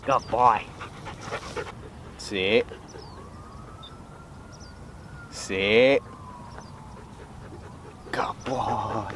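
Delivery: low, soft commands to a dog; talking to a dog. Real, not acted. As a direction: quietly correct and praise the dog. Shock mode recall and sits.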